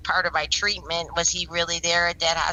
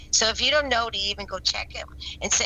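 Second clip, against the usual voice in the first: ordinary talk, nothing marked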